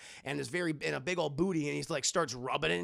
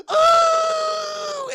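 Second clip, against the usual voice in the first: much higher, much louder shriek; shrieking scream; 13 dB louder than usual. Acted. Willie shrieking at the giant bug on her hand.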